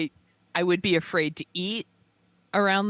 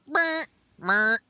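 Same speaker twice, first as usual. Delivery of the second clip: shrugging meh; 4 semitones higher than usual. Retold or imitated. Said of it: "shoulder shrug emoji".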